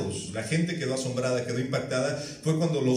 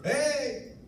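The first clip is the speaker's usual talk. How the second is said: much higher, ringing "¡Hey!" called from afar; calling out; 12 semitones higher than usual. Retold or imitated. Jesus calling to the fishermen from the shore, high call. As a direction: hail the boat from the shore. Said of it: "les gritó".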